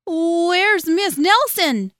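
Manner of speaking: put-on high, loud, drawn-out child voice; kids asking. A narrator's reading. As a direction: wail the question like dismayed pupils. Kids ask the new teacher.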